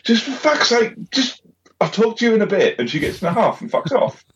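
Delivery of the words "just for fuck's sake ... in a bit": exasperated outburst. Retold or imitated. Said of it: "and I'm like".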